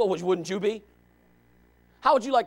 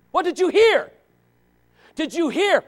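pressing question; 7 semitones higher, 7 dB louder than usual. Real, not acted. Preacher pressing the congregation.

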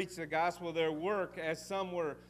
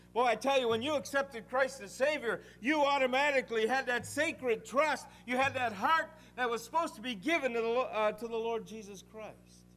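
raised, louder pulpit delivery; fervent preaching; 7 semitones higher, 7 dB louder than usual. Acted. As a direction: preach it out to the congregation.

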